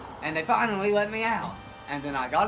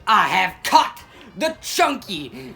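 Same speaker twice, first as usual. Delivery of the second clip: loud, slow, raised proclamation; mock triumphant announcement; 4 semitones higher, 8 dB louder, slower than usual. Officer to the police office, mock triumphant announcement. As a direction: proclaim it grandly, word by word.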